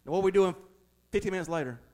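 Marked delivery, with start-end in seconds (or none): none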